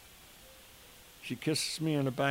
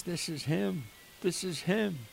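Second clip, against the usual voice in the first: much higher, rising emotion, repeated; overwhelmed recognition; 6 semitones higher than usual. Retold or imitated. Father shown Padre Pio's picture by his daughter, overwhelmed. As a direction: blurt it out, overcome with recognition.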